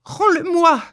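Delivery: high, loud demand; desperate cry. A narrator's reading. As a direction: cry out for your child. (cri désespéré, aigu)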